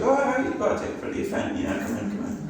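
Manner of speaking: breezy doorman waving folk in; door greeter. Retold or imitated. Painted-up door staff greeting gig ticket holders, high and quick. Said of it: "just like".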